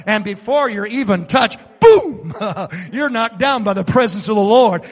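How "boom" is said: explosive shout, much higher; sudden loud boom. Acted. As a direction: blast it out like a hit.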